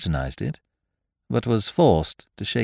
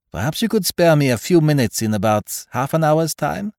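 put-on higher, deliberate detective with foreign accent; Poirot, foreign-accented detective; 9 semitones higher, 5 dB louder than usual. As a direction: propose the test in a French-style accent. Voices Poirot in dialogue.

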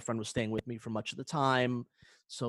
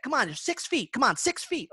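whiny; 10 semitones higher than usual. Retold or imitated. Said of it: "then he's like".

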